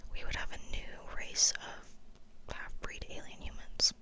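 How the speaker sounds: normal talking voice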